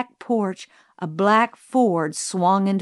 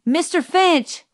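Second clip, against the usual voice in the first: high, urgent mock shout; 8 semitones higher, 3 dB louder than usual. A narrator's reading. Calpurnia calling Atticus on the telephone, shouted. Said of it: "she shouted".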